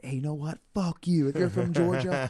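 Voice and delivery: loud, high retold yell at the booing crowd; angry shout. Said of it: "I'm like".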